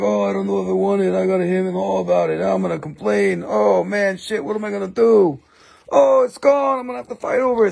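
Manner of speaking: hammed-up fretting over a lost piece; whiny complainers. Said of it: "Everybody's like".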